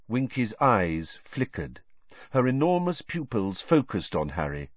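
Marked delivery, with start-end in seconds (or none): none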